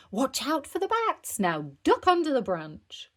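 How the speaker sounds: animated teacher's warning; excited cry. A narrator's reading. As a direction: call out playful dangers. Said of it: "cried Madame Gazelle".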